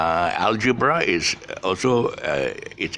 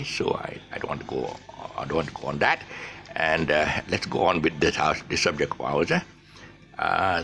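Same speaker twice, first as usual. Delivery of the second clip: ordinary talk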